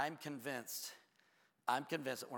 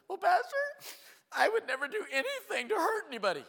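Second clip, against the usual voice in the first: high, loud mock wail; imitated crying; 10 dB louder than usual. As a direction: wail it out like a hurt churchgoer, hammed up. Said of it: "She starts crying".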